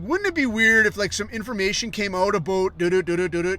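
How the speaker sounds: hammed-up Canadian accent teasing a question. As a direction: imitate an annoying Canadian news guy. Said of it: "that aggravating Canadian accent".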